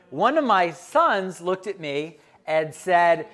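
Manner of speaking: ordinary delivery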